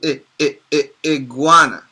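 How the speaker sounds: "I, I, I, iguana" with a clipped short i each time; teacher sounding out the first letter. Shown as a demonstration. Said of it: "is it a short I or a long I?".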